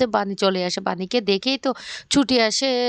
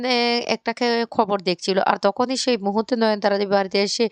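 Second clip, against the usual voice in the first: ordinary delivery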